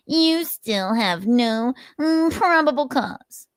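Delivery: high, slow, mocking whine on probable cause; Nick impression. Narrator mocking Nick's comeback to the officer, high and slow. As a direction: stretch it out in a sneering high voice. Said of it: "with Nick's impression".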